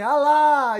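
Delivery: put-on a frightened young man yells "Alá!" on command; trying to shout. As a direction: force the name out as loud as you can. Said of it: "ele tentou gritar".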